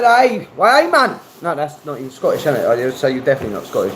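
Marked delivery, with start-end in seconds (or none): 0.0-1.2 high-pitched attempt at a Geordie accent; mock Geordie accent. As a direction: put on a Geordie lilt, pitched well up. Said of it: "Fucking Geordie".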